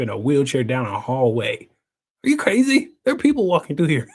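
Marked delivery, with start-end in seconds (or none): 2.2-4.1 high-pitched mock outrage; retold exasperated question; incredulous. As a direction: challenge it in disbelief, voice leaping up. Acted argument about wheeling himself.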